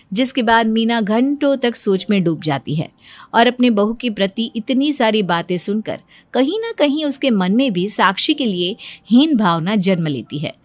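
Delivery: ordinary talk, nothing marked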